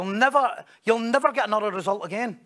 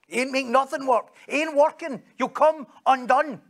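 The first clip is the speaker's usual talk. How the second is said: higher and louder, driving the point home; a preacher's raised warning, slowing at the end; rising loud rebuke; 5 semitones higher, 4 dB louder than usual. Acted. Preacher warning the congregation they'll come undone, loud and high.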